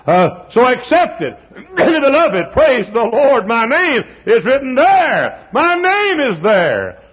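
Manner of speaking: high, loud, laughing praise; joyful preaching peak. Real, not acted. Preacher exulting that his name is in the book, laughing high. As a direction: ring out with glad laughter.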